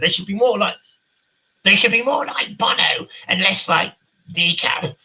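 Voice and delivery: high, loud mocking voice; Sharon Osbourne. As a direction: shriek it in a put-on caricature voice. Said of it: "She said".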